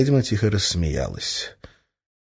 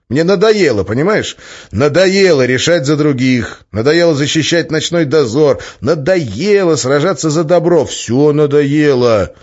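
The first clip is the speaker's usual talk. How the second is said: loud, high, drawn-out outburst; fed-up shouting; 7 semitones higher, 6 dB louder, slower than usual. A narrator's reading. Man fed up with deciding for others, shouting. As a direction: build a raised-voice tirade of exasperation. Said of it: "стою и кричу".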